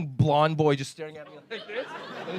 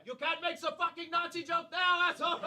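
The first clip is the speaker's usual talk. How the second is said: mock heckler shout; 9 dB quieter than usual. Retold audience member objecting, hands up.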